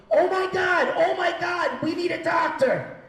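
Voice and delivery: high, loud panicked scream; screaming stewardess. Retold or imitated. Stewardess runs down the plane for help, panicked screaming. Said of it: "the stewardess comes fucking beelining to the back of the plane screaming".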